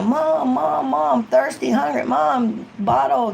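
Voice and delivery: put-on high pleading child calling mom; a toddler begging for a bottle; high nagging kid voice; whiny kid. Kids pestering mom.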